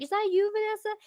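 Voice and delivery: high, mock-curious call; high-pitched playful questioning; imitated squinting searcher. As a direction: peer through a magnifying glass, voice way up high. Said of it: "You're like".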